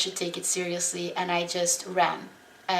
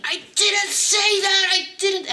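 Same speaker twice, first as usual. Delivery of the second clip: much higher, loud yelled denial; yelling denial; 12 semitones higher, 8 dB louder than usual. Retold or imitated. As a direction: shout it back as if accused. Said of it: "He started yelling".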